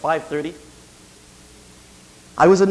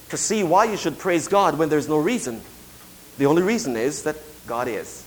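ordinary talk, nothing marked

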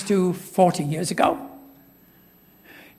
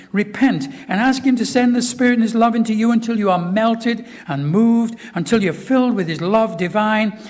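raised, fervent preaching; preaching quote; 4 semitones higher, 4 dB louder than usual. Acted. Preacher quoting Lloyd Jones to the church, fervent. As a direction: exhort them like the old preacher.

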